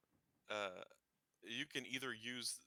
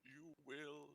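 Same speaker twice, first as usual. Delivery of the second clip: slow, soft and sinister; menacing emperor; 3 semitones higher, 9 dB quieter, much slower than usual. Acted. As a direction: draw it out as a quiet threat. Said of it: "So the Emperor goes".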